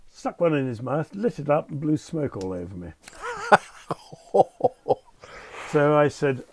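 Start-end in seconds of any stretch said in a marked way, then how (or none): 3.0-3.6 a sudden high laugh at the outrageous moment; high burst of laughter. Real, not acted. Listener laughing as the passenger blows smoke at the captain. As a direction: crack up high at the memory.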